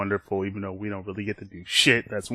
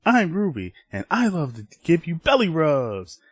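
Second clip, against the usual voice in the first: higher-pitched, playful character voice; cutesy Ruby; 6 semitones higher than usual. Acted. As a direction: offer it sweetly, like talking to a pet. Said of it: "he sees Ruby as".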